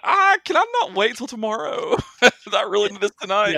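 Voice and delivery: high-pitched, exaggerated reluctant plea; comically put-upon, high voice; mock reluctance. Voicing own reluctance to wait outside.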